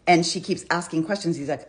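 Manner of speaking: normal talking voice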